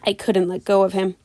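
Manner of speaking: ordinary delivery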